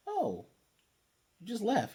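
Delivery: high startled gasp of a line; surprised. Retold or imitated. Hiro startled to see Gamamon back, high surprise.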